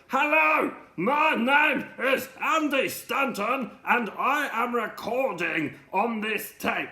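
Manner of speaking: high, slow shouting into the tape; shouting into sellotape to record; very loud. Retold or imitated. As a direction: bellow it slowly and way up high. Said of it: "speak very loudly onto the tape".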